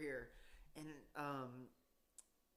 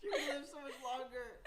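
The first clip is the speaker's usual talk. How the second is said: laughing; 9 semitones higher, 4 dB louder than usual. Real, not acted. Teasing friend about outliving husband.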